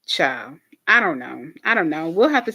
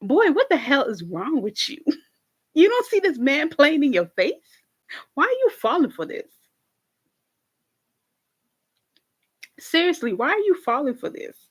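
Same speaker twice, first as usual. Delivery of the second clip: higher, incredulous telling-off; exasperated scolding; 7 semitones higher than usual. Retold or imitated. Talking to Nicky Poo, exasperated high-pitched scolding. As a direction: shake some sense into a clueless friend. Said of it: "I was just like".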